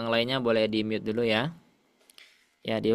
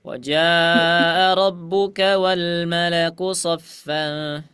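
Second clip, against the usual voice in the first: long held vowels, slow and louder; slow stretched recitation; 3 semitones higher, 4 dB louder, much slower than usual. Shown as a demonstration. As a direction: chant it slowly, vowels long. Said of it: "kunya nggak panjang".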